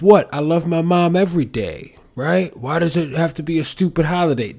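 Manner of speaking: loud, high-pitched protest at a holiday; imitating an indignant objector; mock indignation. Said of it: "I used to have the attitude of".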